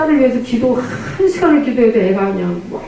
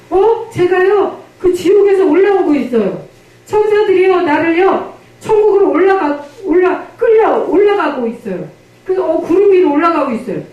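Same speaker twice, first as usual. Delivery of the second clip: put-on excited child; 6 semitones higher than usual. Girl's vision retold excitedly.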